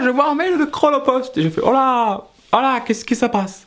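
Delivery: high-pitched mock alarm; alarmed surprise. Comedian replays spotting the courier's mail, high alarmed surprise. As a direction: react with exaggerated dismay, pitch jumping up.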